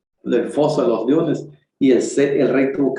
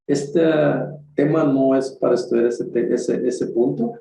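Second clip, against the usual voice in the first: ordinary delivery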